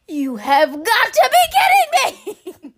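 high, loud laughing you have got to be kidding; loud laughing protest; laughing disbelief. Retold or imitated. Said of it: "I was like".

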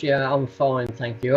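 ordinary delivery

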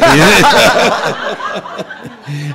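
big loud laugh; hearty laugh. Real, not acted. Laughing at the story.

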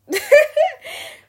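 sudden loud high laugh; a real laugh bursting out high and loud; high burst of laughter. Real, not acted. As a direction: crack up at your own bit. Laughs at own waiter imitation.

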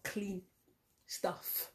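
whispered word. Retold or imitated. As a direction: drop to a whisper. Whispered punchline.

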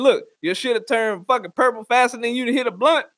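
usual speaking voice

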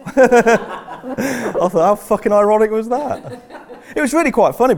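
high, loud, slow through laughter; laughing hard. Real, not acted. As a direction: barely get it out for laughing. Laughing at his own crash.

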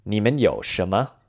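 ordinary talk, nothing marked

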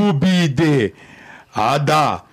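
slow, drawn-out reversed gibberish; speaking backwards. Acted. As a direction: sound out each word as if a tape played in reverse. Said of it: "falando essas coisas ao contrário".

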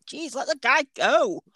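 ordinary talk

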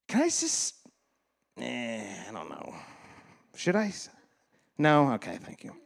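soft, hesitant murmur to oneself. Real, not acted. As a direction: waver quietly over whether to say it.

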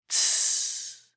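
sharp snake hiss; steam hiss. Acted. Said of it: "said the yellow-eyed green snake".

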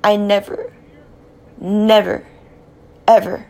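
I never, never, ever; building insistence. Real, not acted. Insisting on own innocence.

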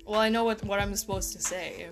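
ordinary talk

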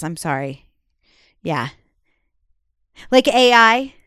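usual speaking voice

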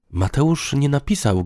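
ordinary delivery, nothing marked

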